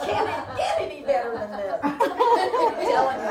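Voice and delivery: laughing. Retold or imitated. Midwife gushing, laughing.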